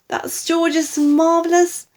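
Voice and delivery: high, loud, urgent warning; crying out. A narrator's reading. Daughter warning about the medicine, high urgent cry. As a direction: warn her in rising panic. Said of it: "cried Missus Cranky".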